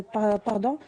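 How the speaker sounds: usual speaking voice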